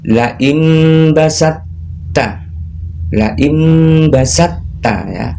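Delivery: imbasatta drawn out, ending on a merged tt; slow recitation demo. Shown as a demonstration. Teacher to parents and children, slow careful recitation. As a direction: recite la imbasatta very slowly, tt held. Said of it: "Cara membacanya".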